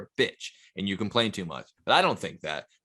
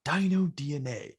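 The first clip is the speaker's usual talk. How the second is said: Jurassic Park host; 4 semitones higher than usual. Retold or imitated. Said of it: "the Jurassic Park guy".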